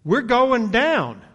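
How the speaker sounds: high, loud, slowing cry of doom; imitating men sure the boat is sinking; terrified disciples. Disciples in the storm crying out in terror. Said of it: "were so terrified that they said".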